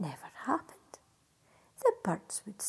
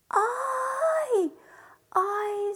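high, loud joyful cry; delighted fairy; 11 semitones higher, 6 dB louder than usual. Acted.

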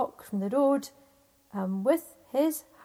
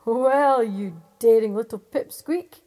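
much louder booming giant voice; roaring giant; 13 dB louder than usual. Acted. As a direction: boom down at the tiny man. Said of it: "he roared with laughter".

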